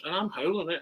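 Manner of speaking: ordinary talk, nothing marked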